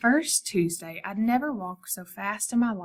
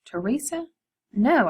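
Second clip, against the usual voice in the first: high, shocked "Teresa?" then a firm "No"; a mild shout of surprise; startled protest; 5 semitones higher, 5 dB louder than usual. A narrator's reading. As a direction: inner protest at the wrong name. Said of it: "I wanted to shout".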